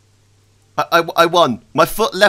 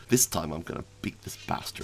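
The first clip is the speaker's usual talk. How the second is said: whispered, much softer vow; determined whisper; 11 semitones lower, 13 dB quieter than usual. Real, not acted. Gamer whispering a revenge vow at the enemy. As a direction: breathe the threat under your breath.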